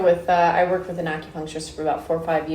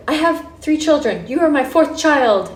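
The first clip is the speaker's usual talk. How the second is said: high-pitched, emphatic declaration; retold motherly insistence, rising louder; imitated motherly lady; 9 semitones higher, 5 dB louder than usual. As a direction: voice a caring lady, pitched right up. Voicing the lady comforting her.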